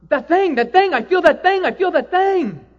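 frantic retold awe; frantic wonder. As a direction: grasp for words in awe. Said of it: "she's just almost frantic".

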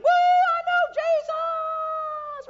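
shrill, overjoyed whoop; excited cheer. Retold or imitated. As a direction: cheer like a brand-new believer. New convert's excited cheer.

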